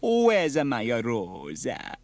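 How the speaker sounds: loud, very drawn-out plaintive question; Bella, anxious. Acted. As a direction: stretch each word in theatrical worry. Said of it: "said Bella anxiously".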